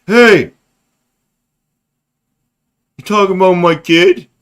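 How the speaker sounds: loud, high indignant parent; offended parent. Retold or imitated. Audience member snapping back about her kid, loud and high. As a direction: play the outraged mom in the audience. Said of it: "somebody in the audience that's gonna be like".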